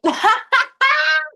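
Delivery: bright high laugh after the joke. Real, not acted. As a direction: laugh at your own punchline. Laughs after her bit.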